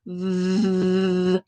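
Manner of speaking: long steady vvv, teeth on lip; V as a sustained low-level buzz; buzzing V sound. Shown as a demonstration. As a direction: buzz the V and hold it. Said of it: "we make a buzzing sound like this".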